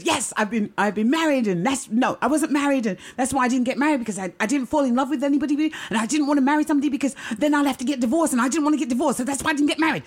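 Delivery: mock angry, rushed rant; hammed-up flustered self-justifying tirade; imitating angry man. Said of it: "he got really angry".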